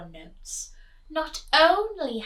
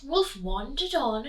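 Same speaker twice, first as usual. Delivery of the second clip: usual speaking voice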